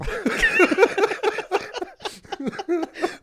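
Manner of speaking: loud squealing laugh; high burst of laughter. Real, not acted. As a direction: break into a high helpless laugh.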